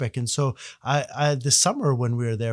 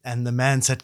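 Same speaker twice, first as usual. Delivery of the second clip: ordinary talk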